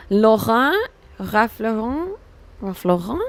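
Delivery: Laurent and Lauren with a throaty French R and nasal ending; French pronunciation. Shown as a demonstration. Streamer testing if the name is French, French-style "Laurent" and "Lauren".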